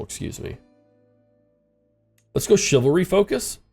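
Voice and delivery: ordinary delivery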